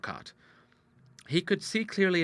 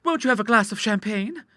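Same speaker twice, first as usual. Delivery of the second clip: much higher voice of a daughter; eager girlish offer; 10 semitones higher, 4 dB louder than usual. A narrator's reading. Daughter offers father a drink, high and eager. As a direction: pitch it high, as an inviting girl. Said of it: "cried Ida".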